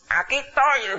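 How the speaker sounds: high, thin imitation of an old churchgoer; old man's voice. Preacher imitating the old man arriving at church, high old man's voice. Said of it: "hablaba con esa voz".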